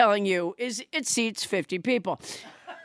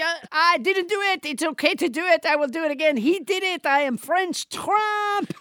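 put-on French accent; 4 semitones higher than usual. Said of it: "second draft on all his accents".

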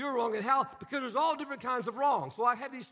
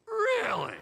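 much higher, disbelieving really; sarcastic really; 11 semitones higher, 4 dB louder than usual. Retold or imitated. Pastor answering a complaining wife, sarcastic. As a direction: let the disbelief drip.

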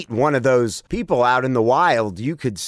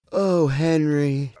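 mock moan; slower than usual. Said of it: "I'm at work and I'm like".

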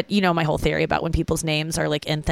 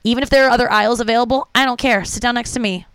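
higher, louder, eager call to sit down; eager invitation; 5 semitones higher, 4 dB louder than usual. Retold or imitated. Comic inviting a fat passenger to sit by her, eagerly. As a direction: beckon the stranger over with glee. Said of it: "I'm like".

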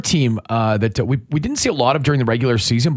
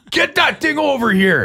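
much higher, demanding dad demo; 12 semitones higher than usual. Shown as a demonstration. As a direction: show off your bossiest dad voice. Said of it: "This is my dad voice".

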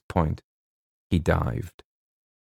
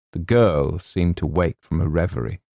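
ordinary delivery, nothing marked

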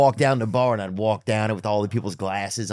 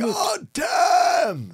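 father's shout. Retold or imitated. Guest imitating his father's constant cursing, shouted high. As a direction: bark it like a grumpy dad. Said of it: "what my father used to say".

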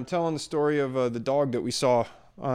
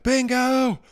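one high yelled name; calling the dog; 13 semitones higher than usual. Retold or imitated. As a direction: shout the name up high to the street. Owner calling his lost dog.

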